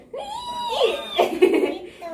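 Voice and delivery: squealing laugh, high up; high burst of laughter. Real, not acted. Child's answer amuses the adult.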